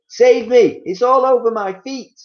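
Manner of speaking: high, loud terrified pleading; panicked scream. Acted. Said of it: "screamed Missus Twit".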